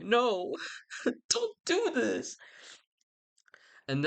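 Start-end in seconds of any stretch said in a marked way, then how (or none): 0.0-2.4 high, drawn-out mock wail; mock anguish. Fan pleading over a favourite's death, mock anguish. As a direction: plead in a squeaky, hammed-up cry. Mourns Mercutio's death in play.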